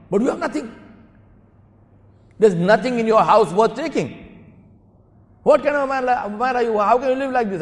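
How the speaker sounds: high, loud, hammed-up scolding; indignant lecturing. Thief lecturing his victim on an empty house, indignant. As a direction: act out an incredulous telling-off. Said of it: "giving Hasan, Hasan Basri some bhashan".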